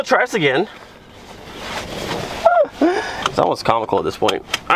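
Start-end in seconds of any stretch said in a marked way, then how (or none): 1.6-3.3 airy high-pitched disbelieving laugh; high exasperated laugh. Real, not acted. Exasperated laugh at failed inspection.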